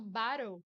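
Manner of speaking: bottle with the double T flapped to R; American flap T. Shown as a demonstration. Teacher showing the American T as R. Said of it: "substitui o som dos dois T's por um R".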